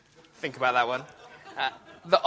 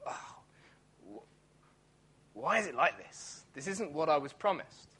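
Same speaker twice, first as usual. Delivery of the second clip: bitter muttered complaint; 7 dB quieter than usual. Retold or imitated. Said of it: "get bitter and twisted and think".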